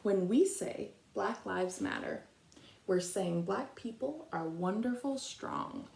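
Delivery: gentle read-aloud picture book voice, long pauses between verses; reading to children. Acted.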